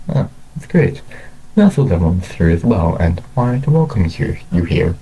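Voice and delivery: plain reading voice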